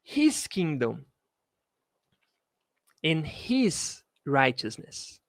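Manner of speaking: slow, careful verse reading; measured teacher's reading; slow model reading. Shown as a demonstration. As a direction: lay out each word slowly for learners. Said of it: "teacher models slowly".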